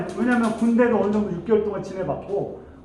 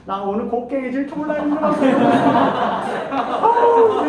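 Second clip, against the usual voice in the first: high laughing groan; mock whine dissolving in laughter; 6 semitones higher, 4 dB louder than usual. Real, not acted. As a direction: laugh through the whiny complaint. Whining boyfriend, laughing.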